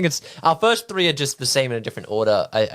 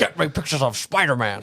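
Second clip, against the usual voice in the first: gruff editor. Retold or imitated. J. Jonah Jameson quote.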